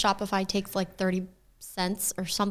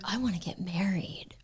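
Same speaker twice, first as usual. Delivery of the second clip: whispered; 6 dB quieter than usual. Retold or imitated. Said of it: "where I'm like".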